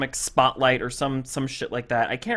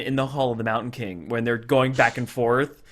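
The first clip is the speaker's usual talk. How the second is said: ordinary delivery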